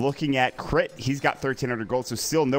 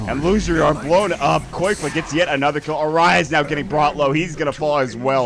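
excited play-by-play; 3 semitones higher, 6 dB louder than usual. Real, not acted. Loud call of team wipe.

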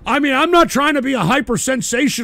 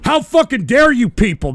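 ordinary talk, nothing marked

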